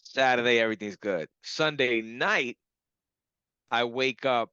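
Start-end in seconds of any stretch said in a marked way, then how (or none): none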